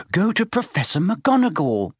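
put-on high, insistent girl's voice; urging. A narrator's reading. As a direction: press him firmly. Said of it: "Hermione urged Neville".

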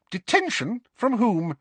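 high-pitched, incredulous questioning; sharp. A narrator's reading. Professor questions pupil about his detention, sharp. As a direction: demand it sharply. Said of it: "said Professor McGonagall sharply".

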